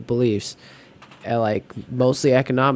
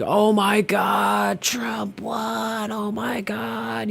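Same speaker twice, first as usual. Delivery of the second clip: high, drawn-out mock wail; mock screaming; 10 semitones higher, much slower than usual. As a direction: stretch it out like an overwrought panicked screamer. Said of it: "yelling and screaming".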